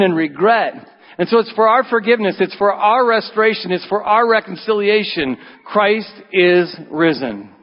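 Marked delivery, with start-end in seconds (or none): 1.2-7.5 building preaching. Real, not acted. Preaching climax.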